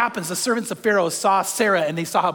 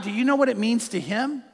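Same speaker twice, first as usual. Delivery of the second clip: ordinary talk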